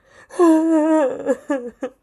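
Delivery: loud laughing wail that mimics sobbing; hammed-up crying laugh; mock sob. Shown as a demonstration. Vlogger showing how she wept at the movie, mock sobbing. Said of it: "like that".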